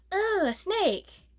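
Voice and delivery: high, slow mock sneer of the evil lady. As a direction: drag it out like a snooty villain. Mocking the evil lady's voice.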